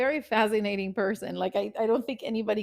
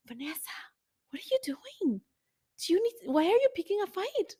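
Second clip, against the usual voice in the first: high, soft self-scolding; inner voice; 9 semitones higher, 4 dB quieter than usual. Retold or imitated. Her inner voice asking why she picks fights, high. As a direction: question yourself in a small, high voice. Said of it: "I can hear myself going like".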